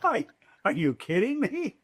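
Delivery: disbelieving, rising in pitch; amazed he'd be paid to talk; incredulous inner thought. Retold or imitated. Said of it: "in my mind, I'm thinking".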